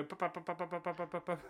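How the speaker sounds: soft mouth-drum ba ba; drum sound imitation. Shown as a demonstration. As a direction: tap out a drum beat with your voice. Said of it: "what are those ones where you go".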